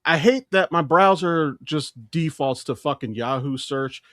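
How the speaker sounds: usual speaking voice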